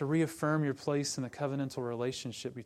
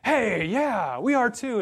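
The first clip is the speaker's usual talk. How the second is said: higher, louder mock-eager "hey, yeah"; eager agreement; 8 semitones higher, 8 dB louder, slower than usual. Pharisees siding with Paul, hammed-up eager. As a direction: jump in like excited kids. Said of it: "the Pharisees are like".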